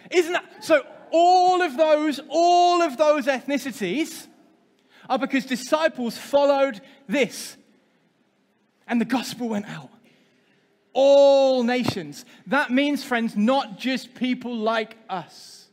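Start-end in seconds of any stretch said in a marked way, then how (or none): 0.1-7.6 loud, slow, higher emphatic push. Real, not acted. Preacher's emphatic point to group. 10.9-12.3 "All" drawn out slow and loud; stress on all. Real, not acted. Preacher stresses key phrase.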